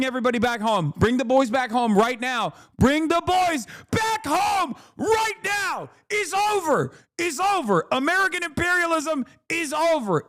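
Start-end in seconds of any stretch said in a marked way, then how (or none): 2.8-5.9 much higher, slow yelled demand; high drawn-out shout. Real, not acted. 6.1-10.2 much higher, strained declaration; high exasperated proclamation. Real, not acted. Declaring defeat.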